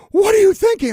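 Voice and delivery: high, loud panicked shout; frantic yell. Retold or imitated. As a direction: burst in yelling in alarm. Out-of-breath intern bursts in.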